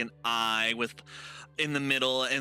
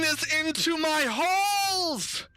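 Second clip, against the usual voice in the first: high, slow, booming outrage; outraged shout; 4 dB louder, slower than usual. Acted. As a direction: roar at an intruder in your halls. Game master voices outraged being.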